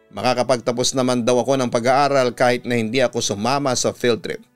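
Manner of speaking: ordinary delivery, nothing marked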